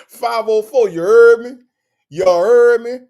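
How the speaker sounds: loud hyped-up call to New Orleans; hype shout. Real, not acted. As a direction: shout it out to the 504 crowd. Hyping New Orleans viewers.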